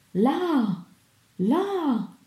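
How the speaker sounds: high, drawn-out strangled exclamation; mock strangled cry of alarm. A narrator's reading. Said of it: "esclamò egli con voce strozzata".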